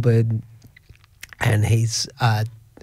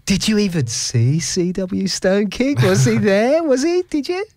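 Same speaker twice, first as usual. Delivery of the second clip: rising, higher-pitched mimicked questions; teasing doubt; 8 semitones higher than usual. Friends to son after star vanished, teasing disbelief. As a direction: needle him with mock disbelief, laughing through it.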